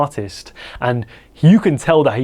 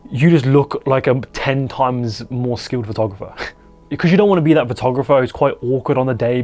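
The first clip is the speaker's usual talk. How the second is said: usual speaking voice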